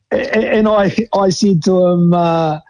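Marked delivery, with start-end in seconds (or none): none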